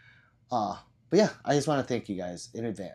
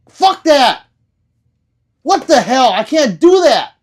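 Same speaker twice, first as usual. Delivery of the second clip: much higher, much louder shout; real loud outburst; 12 semitones higher, 16 dB louder, slower than usual. Real, not acted. Streamer quitting the ASMR bit, shouting. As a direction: burst out of the whisper, yelling.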